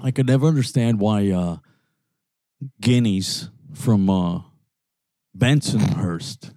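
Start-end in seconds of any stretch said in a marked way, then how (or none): none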